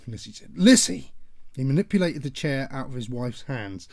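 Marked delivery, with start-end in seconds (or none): 0.6-1.1 loud, high name called out; father warning his wife; warning shout. Acted. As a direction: bark her name.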